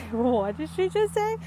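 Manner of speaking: imitating a shocked gasp of a question. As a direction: play the grandma caught off guard. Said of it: "she was in, like, in shock".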